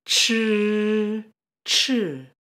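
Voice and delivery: "Chi" as a buzzing CH, first held long, then short and falling; CH blender sound. Shown as a demonstration. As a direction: draw out "Chi" like a blender with CH in front. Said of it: "sounds like the blender noise".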